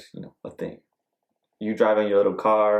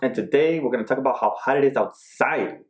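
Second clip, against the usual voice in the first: higher-pitched playful intro; odd, silly talking; 5 semitones higher than usual. Real, not acted. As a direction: open the show in a silly, lilting way. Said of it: "I don't know why I'm talking like this".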